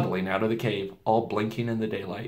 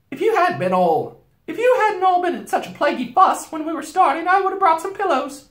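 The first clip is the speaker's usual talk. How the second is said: high, much louder scolding beaver wife; fussing Mrs Beaver; 6 dB louder than usual. A narrator's reading. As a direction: grumble like a fussy housewife beaver. Mrs Beaver scolds about the hurry.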